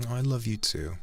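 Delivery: usual speaking voice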